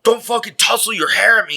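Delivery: high-pitched, mock-angry warning; retold angry warning. Shirtless guy getting in a passerby's face, mock-angry. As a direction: imitate an agitated stranger starting trouble.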